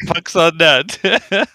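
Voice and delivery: "puck's on net" stretched very slowly, rising at "net"; slow, drawn out. Retold or imitated. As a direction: drag out "puck's on net" slowly, end on "net" going up. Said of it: "hockey talk in it".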